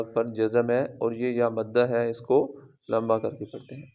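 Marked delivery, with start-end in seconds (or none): none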